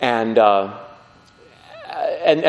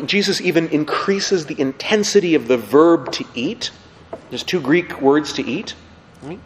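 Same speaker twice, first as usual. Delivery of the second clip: ordinary talk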